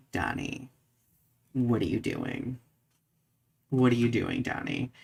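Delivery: usual speaking voice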